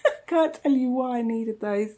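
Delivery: giggling through the admission; amused confession with a laugh. Real, not acted. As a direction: laugh it out, a bit embarrassed. Laughing at own purchase.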